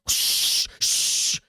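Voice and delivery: two long, forceful shushes; sharp conspiratorial "shh"; hushing. Acted. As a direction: shush them urgently, twice. Guard hushes the player.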